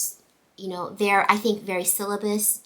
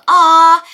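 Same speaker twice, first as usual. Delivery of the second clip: loud, high mock whine "aww"; whining groan; 9 semitones higher, 19 dB louder than usual. Teacher mimics students who don't want to end math, whining. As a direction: imitate students whining in protest, much louder and higher. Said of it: "they whine, they go".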